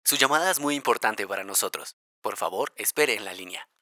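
slow recorded hold message voice; automated call center greeting; phone menu voice. Pretend. Podcast opens with hold message.

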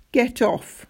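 lightly acted sob, pushing someone away; sobbed. A narrator's reading. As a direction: protest through tears. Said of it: "sobbed Roderick".

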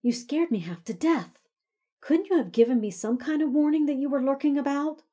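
high, loud, rattled scolding; angry hiss. A narrator's reading. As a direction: snap at him, still shaken. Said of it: "she hissed".

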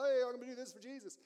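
high-pitched mock eagerness; eager volunteer. Drummer offering his gift to Jesus, eager volunteer. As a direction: pipe up like a keen helper.

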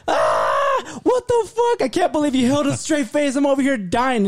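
high-pitched shrieking disbelief; imitated busting up. Employee bursts through door to his supervisor, hysterical mock outburst. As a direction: act out a coworker cracking up in disbelief. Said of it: "cr-, busting up laughing".

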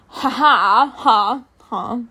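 hammed-up booming "ha ha"; mock big booming laugh in spaced-out bursts; booming fake laugh. A narrator's reading. Narrator acts out Emmett's laugh, booming mock laugh. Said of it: "he said with his booming laugh".

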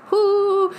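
high-pitched "whoo" exclamation. Real, not acted. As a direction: let out a long, high whoo. Exclaims at imagining being seen.

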